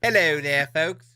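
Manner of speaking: high, hammed-up British accent. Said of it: "read that in a British accent".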